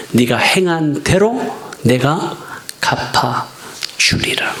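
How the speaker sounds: slow reverent pronouncement dropping to a whisper; solemn scripture. Acted. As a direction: intone it like God's final promise. Quoting Revelation solemnly.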